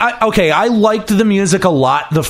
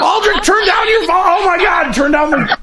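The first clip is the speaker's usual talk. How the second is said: high laughing yell; laughing shout; 13 semitones higher than usual. Real, not acted. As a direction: yell over the echo, laughing. Caller's echo, host yells.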